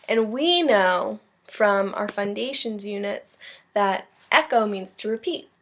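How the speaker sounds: ordinary delivery, nothing marked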